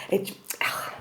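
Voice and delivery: hushed whisper aside. Real, not acted. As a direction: whisper it off to the side. Aside to off-camera person.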